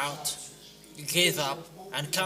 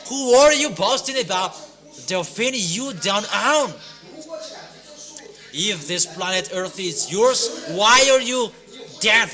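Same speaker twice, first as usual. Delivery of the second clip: loud, pounding sermon delivery with higher pitch; fiery preaching; 4 semitones higher, 6 dB louder than usual. Real, not acted. Preacher condemns the unbelievers in a loud, driving sermon. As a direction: preach it hard to the crowd, almost shouting. Preacher exhorting crowd loudly.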